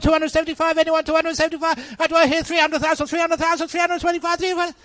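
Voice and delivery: auctioneer's chant climbing to a frenzy; excited auctioneer. Retold or imitated. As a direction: build from measured patter to high, loud rapid-fire bidding. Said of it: "how the auctioneer started getting people excited".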